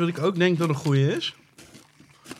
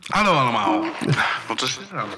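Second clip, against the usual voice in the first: megaphone. Real, not acted. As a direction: voice through a megaphone. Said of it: "Dat is een megafoon".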